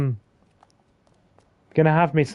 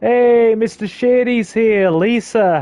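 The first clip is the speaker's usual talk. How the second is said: loud greeting; 6 semitones higher, 3 dB louder, slower than usual. Real, not acted. Greeting a viewer.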